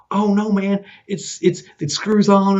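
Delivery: high, loud, insistent correction; earnest protest. Retold or imitated. Nice buddy corrects a teasing coworker, high earnest protest.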